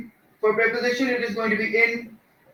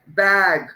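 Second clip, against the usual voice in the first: बैग with the vowel drawn out, falling; stressed बैग; 4 semitones lower, much slower than usual. Shown as a demonstration. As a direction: lean on the start of बैग and stretch it. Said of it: "पहले letter को stress करेंगे".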